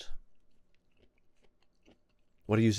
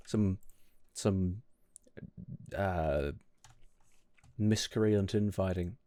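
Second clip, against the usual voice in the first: ordinary talk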